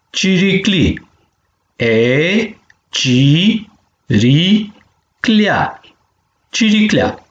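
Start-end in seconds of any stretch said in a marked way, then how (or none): 1.7-5.8 syllable by syllable. Shown as a demonstration. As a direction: chant out ci - ri - clea for the class to repeat. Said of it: "penem palalman de vitumen". (pe silabe: ci, ri, clea)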